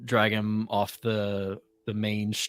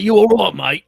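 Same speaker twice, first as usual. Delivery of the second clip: loud, higher "You all right, mate?"; hearty check-in; 10 semitones higher, 12 dB louder than usual. Acted. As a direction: ask it big and friendly. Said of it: "It's like".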